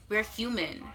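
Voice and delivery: ordinary talk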